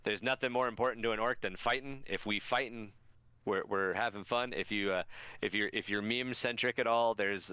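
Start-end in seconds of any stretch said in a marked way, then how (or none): none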